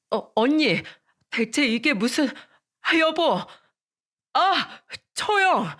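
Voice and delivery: frightened cries rising to a high pained yell; panicked yelps. Acted. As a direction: cry out in panic as the hair is yanked. Brother-in-law attacked, crying out. (머리채 잡혀 겁에 질려 비명 지르듯)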